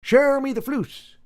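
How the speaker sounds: movie quote impression. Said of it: "I'll be like".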